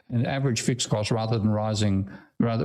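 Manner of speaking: normal talking voice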